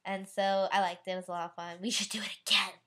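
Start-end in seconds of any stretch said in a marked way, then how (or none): 1.8-2.7 whisper. Real, not acted. Secret aside to friends.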